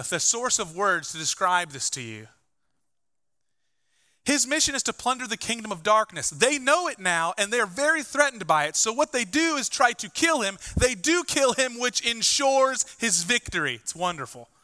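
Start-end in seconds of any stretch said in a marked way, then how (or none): 4.2-13.8 higher-pitched, emphatic preacher's delivery; impassioned preaching. Real, not acted. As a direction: preach it with rising fervour, hitting the key words.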